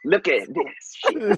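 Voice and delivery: slow, lightly voiced drunk boast; slow drunk show-off. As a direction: drag it out like a tipsy show-off. Wife strutting, showing off drunk.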